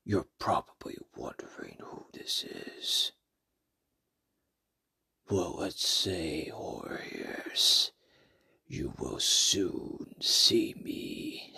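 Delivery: low eerie whisper, slow and breathy-free hush; creepy whisper. Acted. Mystery host whispering to listeners. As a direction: hiss the intro like a lurking ghoul.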